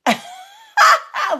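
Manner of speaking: sudden high burst of laughter; big real laugh; loud high laugh. Real, not acted. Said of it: "loud laugh".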